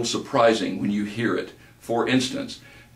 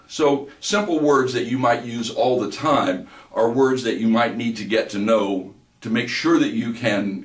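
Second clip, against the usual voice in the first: ordinary talk, nothing marked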